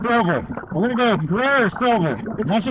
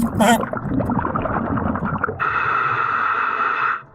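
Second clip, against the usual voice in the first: breathless laugh. Real, not acted. Laughs at own squeaky voice.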